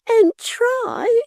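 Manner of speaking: very high, slow, mock hysterics; a prude squealing in alarm; Miggs, hysterical. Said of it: "said Miggs hysterically".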